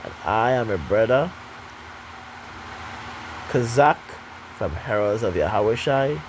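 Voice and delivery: low, measured benediction voice; solemn closing blessing. Acted. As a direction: intone the closing greeting gravely.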